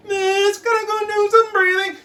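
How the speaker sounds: put-on whiny kid. Whiny kid begs the teacher, high whine. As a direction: whine like a pleading pupil. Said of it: "always a whiny kid going".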